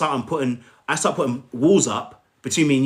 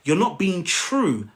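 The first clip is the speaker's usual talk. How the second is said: ordinary delivery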